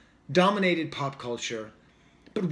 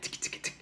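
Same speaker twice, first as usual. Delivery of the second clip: quick unvoiced ticks, softly; hi-hat imitation; 11 dB quieter than usual. Critic describing a trap beat, imitating the hi-hat. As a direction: imitate a hi-hat with soft mouth clicks. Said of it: "you know, the hi-hat".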